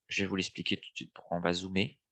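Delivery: ordinary talk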